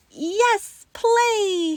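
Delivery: put-on high, loud child's shout; screamed. A narrator's reading. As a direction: shout it out in delight. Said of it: "he screamed".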